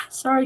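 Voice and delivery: a real tired sigh; weary sigh. Real, not acted. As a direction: sigh before apologizing. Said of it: "I'm getting a little bit emotional".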